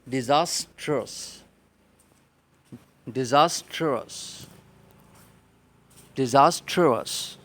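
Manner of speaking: ڈیزاسٹرس said loud as "di-zas-ters", slow on the first takes; model pronunciation. Shown as a demonstration. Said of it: "word ہے".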